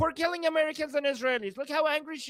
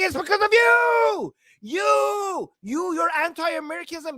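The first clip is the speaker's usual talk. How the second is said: loud, higher accusing yell; angry shout; 3 semitones higher, 5 dB louder, slower than usual. Real, not acted. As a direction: shout the blame right at her. Ranting back at angry woman.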